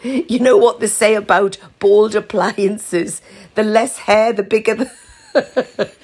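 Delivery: slow, giggly friend voice; laughing. A narrator's reading. Ruth laughing at her own rude joke, slow and giggly. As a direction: chuckle through the innuendo. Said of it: "laughed Ruth".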